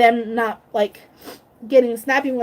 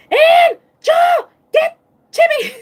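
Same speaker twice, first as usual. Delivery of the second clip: much higher, louder panicked call for help; scared shout; 6 dB louder, slower than usual. Retold or imitated. Scared of the dog, shouting to Angel for help. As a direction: yell for help, frightened. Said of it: "I was scared".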